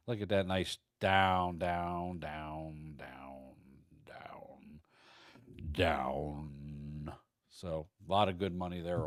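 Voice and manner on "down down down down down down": fading away. Pretend. Price sliding down the chart.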